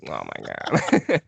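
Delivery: slow low oh my God; exasperated groan. Retold or imitated. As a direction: drag it out, fed up. Retold exasperated reply.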